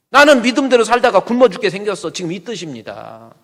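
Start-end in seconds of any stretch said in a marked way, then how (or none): 0.1-2.1 indignant cry. Acted. Asaph voiced, bitter complaint.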